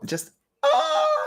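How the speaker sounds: a sudden high squeal of excitement; excited shriek. Real, not acted. Vlogger bursting with excitement to her viewers, high squeal. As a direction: squeal out with giddy delight.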